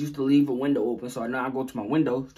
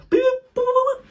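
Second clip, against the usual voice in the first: mock punch sounds; 6 dB louder than usual. Imitating fighting moves.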